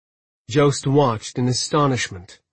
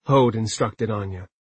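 plain reading voice

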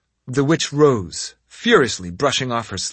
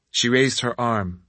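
ordinary delivery

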